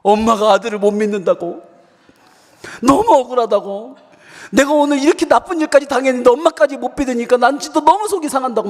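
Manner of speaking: put-on high, plaintive child's complaint; hammed-up wounded protest; mock tearful wailing. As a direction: imitate a kid crying over injustice. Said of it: "진짜 서럽게 펑펑 울었어요".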